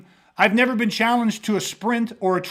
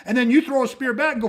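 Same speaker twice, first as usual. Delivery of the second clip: ordinary talk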